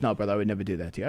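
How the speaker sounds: low, quick, soft reassuring voice; a gentle agent soothing him, low and hushed; soft kind guard. Retold or imitated. TSA guy reassures the traveler, low quick and soft.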